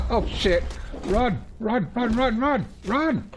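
high, loud panicked yelling; being chased. Real, not acted. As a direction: shout to run in real alarm. Fleeing from attacking enemies.

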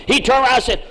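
ordinary talk

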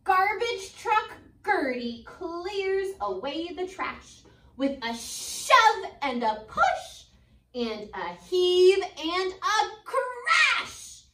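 ordinary delivery

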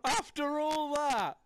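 high, loud anguished wail; crushed disbelief; dismayed cry. Real, not acted. Gets killed at the end.

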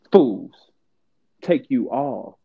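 usual speaking voice

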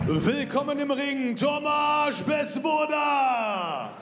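high, slow, stretched ring announcer shout; drawn-out fighter name shout. Acted. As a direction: drag the name out over the crowd.

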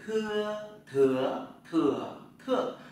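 "thưa" with a puff of air, through four tones, loud; aspirated thưa drill. Shown as a demonstration. Teacher showing students the aspirated "thưa". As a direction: breathe out hard on "thưa" each tone. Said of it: "Chữ tờ các bạn đọc thành chữ thờ nhé".

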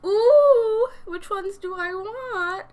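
high, drawn-out excited squeal. Real, not acted. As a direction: squeal with delight at the options. Squealing over eyelash choices.

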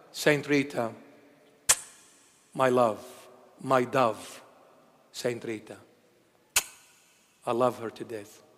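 low, hushed words of adoration; tender devotion. Real, not acted. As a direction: speak softly and fondly, pausing between phrases. Fond devotion to a beloved saint.

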